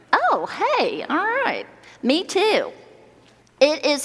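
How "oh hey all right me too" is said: very high, playful falsetto; playful squeal. Pretend. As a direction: squeak it out like a mock reply from the crowd. Mock response to own show-of-hands question.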